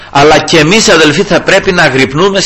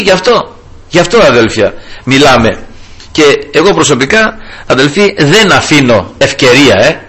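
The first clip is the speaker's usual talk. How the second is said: ordinary talk, nothing marked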